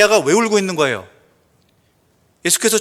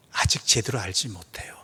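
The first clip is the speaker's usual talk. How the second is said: hushed, lower whisper; 10 semitones lower, 10 dB quieter than usual. Real, not acted. Pastor confiding to the congregation in a soft whisper. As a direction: breathe it out as a secret.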